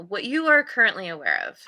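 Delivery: normal talking voice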